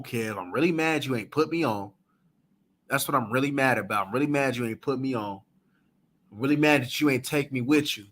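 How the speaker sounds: low-pitched imitation of a disgruntled man; mock confession. Mocking a man upset that Kev didn't put him on, low mock confession. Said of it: "so what you really on is be like".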